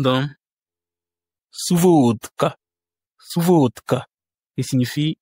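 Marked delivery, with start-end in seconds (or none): 1.5-4.1 suvodka with a long, higher-pitched "VOD"; stress on O. Shown as a demonstration. Teacher gives the example for V, stress on O. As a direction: stretch the o of suvodka, d sounding as t. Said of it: "l'accent tonique est mis sur le O".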